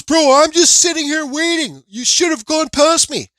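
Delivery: very high, loud mimicked driver; retold indignant protest. Other driver blaming him for waiting, retold indignant protest.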